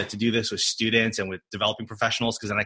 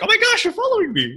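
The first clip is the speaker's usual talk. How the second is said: high, excited squeal; excited gush; 4 dB louder than usual. Retold or imitated. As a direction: gush like a starstruck fan. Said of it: "I'm like".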